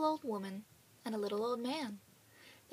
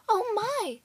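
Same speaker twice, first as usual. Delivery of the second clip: high, loud alarmed "Oh my!"; a sudden fright at the smell; startled cry; 11 semitones higher, 8 dB louder than usual. Acted. Old woman smells burning, cries out in alarm. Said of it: "at last sniffing the air".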